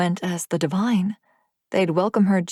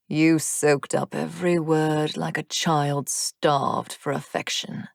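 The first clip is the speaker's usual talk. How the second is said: wicked demon taunt; 2 semitones lower than usual. Acted. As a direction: savour the cruelty slowly. Rion taunting cruelly.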